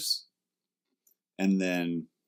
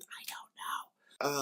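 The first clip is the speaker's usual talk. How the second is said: slow breathy whisper; whispered shrug; 7 semitones higher, 12 dB quieter, much slower than usual. Acted. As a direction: trail off in a whisper.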